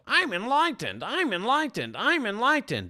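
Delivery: high, airy sing-song boast; mock the self-declared enlightened one, high and smug. Mocking a smug fake guru, high and sing-song.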